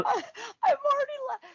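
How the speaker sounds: breaking into high laughter; words dissolving into a laugh. Real, not acted. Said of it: "I'm laughing 'cause you're hilarious".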